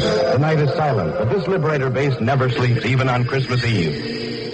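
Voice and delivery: normal talking voice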